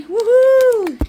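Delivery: high, loud celebratory woohoo; big excited woohoo for the reveal; excited whoop. Real, not acted. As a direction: cheer like you're revealing a prize. Unveiling the Phoenix painting.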